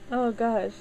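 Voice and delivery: tearful laugh, voice high; teary-eyed. Real, not acted. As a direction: laugh off the tears. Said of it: "I'm getting teary-eyed".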